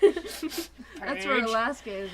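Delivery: slow words through laughter; laughing. Real, not acted. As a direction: laugh while saying it.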